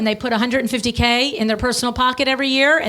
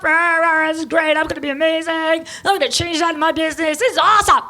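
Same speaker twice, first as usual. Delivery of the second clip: mock pep-rally cheer; 3 semitones higher, faster than usual. Conference attendee gushing after a talk, mock fired-up cheer. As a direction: play a conference-goer pumped with cheap hype. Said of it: "They get all fired up".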